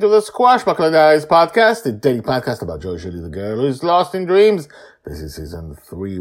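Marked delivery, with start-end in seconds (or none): 0.0-4.7 loud, high, bright podcast host opening; announcer intro. Acted. Host welcoming listeners back, loud announcer voice. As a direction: announce the show with big energy.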